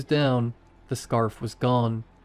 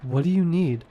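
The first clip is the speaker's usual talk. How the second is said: trembling; 5 semitones higher than usual. A narrator's reading.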